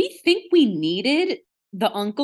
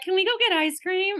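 higher, pleading kid voice, lightly imitated; mock kids asking; 10 semitones higher than usual. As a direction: play the kids begging for ice cream. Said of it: "and they were like".

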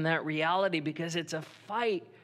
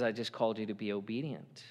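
calm voice of God; 7 semitones lower, 8 dB quieter, faster than usual. Acted. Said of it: "God says".

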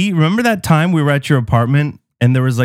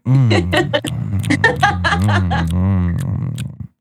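imitating dog licking sounds; 10 semitones lower, much slower than usual. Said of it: "She's just like".

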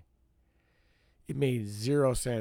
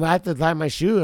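loud, higher mock excuse; imitating the woman; 6 semitones higher, 9 dB louder than usual. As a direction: whine her excuse back. Woman excusing herself, retold argument.

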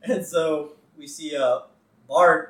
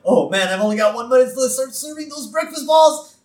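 high, loud cartoon panic; frantic Homer; 9 semitones higher, 6 dB louder than usual. Homer racing to make breakfast in time, frantic. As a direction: play Homer racing against the clock. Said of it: "Homer just hauling ass in his car".

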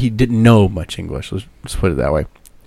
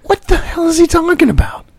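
grandma baffled. Retold or imitated. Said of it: "she's like".

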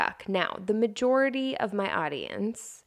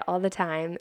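ordinary talk, nothing marked

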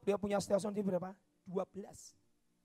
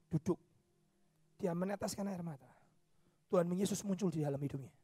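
ordinary talk, nothing marked

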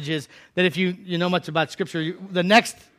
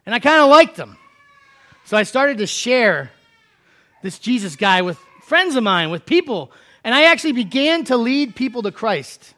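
lightly voiced friend; 4 semitones higher, 6 dB louder than usual. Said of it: "who said".

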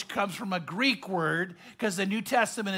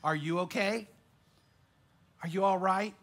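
soft, caring uncle voice; gentle concern; 3 semitones lower, 6 dB quieter than usual. Shown as a demonstration. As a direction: reassure him quietly. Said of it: "did you notice the difference?".

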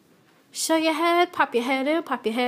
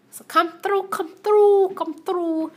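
high, repeated come-on; high inviting singsong call; calling people over; 8 semitones higher than usual. Real, not acted. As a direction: urge them in on a raised pitch. Urging listeners to turn up.

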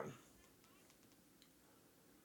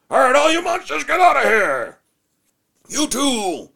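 high, loud, mock-brave dad shooing monsters; hammed-up dad voice; 13 semitones higher, 5 dB louder than usual. Father hamming it up for his scared son, loudly high. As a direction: play the over-the-top father yelling at monsters.

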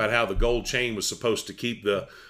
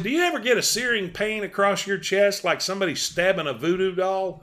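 high, raised voice; wife calling down; 9 semitones higher than usual. Retold or imitated. Wife asking husband about a pain, high calling voice. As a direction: pitch it up as the wife.

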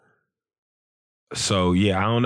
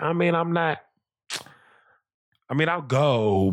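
put-on higher, noncommittal kid voice; reluctant daughter; 6 semitones higher than usual. Daughter on going to the school dance, imitated reluctance. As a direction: lightly imitate a grudging girl.

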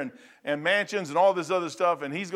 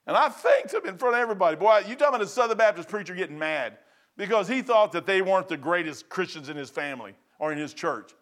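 ordinary talk, nothing marked

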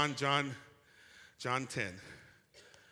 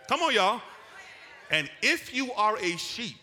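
loud, raised preacher's call; full-force preaching; 6 semitones higher, 8 dB louder than usual. Real, not acted. Preacher urging the congregation to respond, full-force preaching. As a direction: rouse the congregation at high volume.

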